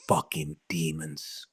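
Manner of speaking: whispered curse. Real, not acted. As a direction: spit it out under your breath. Muttered curse at demons.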